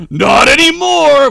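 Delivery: loud, slow, final; cold reply. Acted. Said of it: "Vlog's dad replied".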